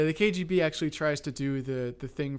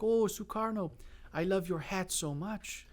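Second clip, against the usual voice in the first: put-on a weak stab at a Russian accent; half-hearted Russian accent, high start; failed Russian accent; 4 semitones higher, 3 dB quieter, slower than usual. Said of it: "I can't do a Russian accent".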